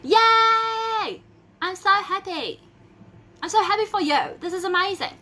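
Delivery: high, loud gushing; excited cheering. Real, not acted. Host cheering for the winner Hannah. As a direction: gush with happiness for her.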